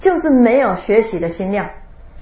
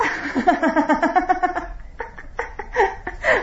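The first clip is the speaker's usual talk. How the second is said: high breathy laugh rising; laughing at the joke; airy giggle; real laughter; 8 semitones higher, 5 dB quieter than usual. Real, not acted. Laughs after punchline.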